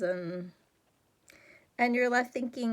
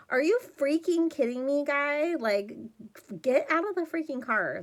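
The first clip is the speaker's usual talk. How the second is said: exasperated; 6 semitones higher, 4 dB louder than usual. Real, not acted. Exasperated at the dumb character.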